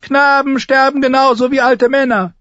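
loud, very high, thin voice; piercing high-pitched menace; threatening knight. A narrator's reading. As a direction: shout a cold threat up at the wall. Sir Amory answers in shouted threat.

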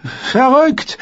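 high, loud indignant cry; loud pleading protest. A narrator's reading. Prisoner dragged to the cell by guards, loudly protesting. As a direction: wail it out in protest. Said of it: "der laut flehte und zeterte".

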